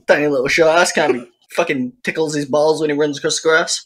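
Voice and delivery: ordinary delivery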